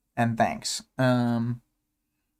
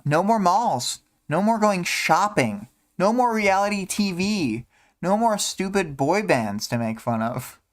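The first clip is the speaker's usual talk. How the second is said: a lightly voiced, wistful girl, a bit higher; reading a character; 7 semitones higher than usual. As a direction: list the things she'll miss in a light character voice. Reads Imp's lines aloud.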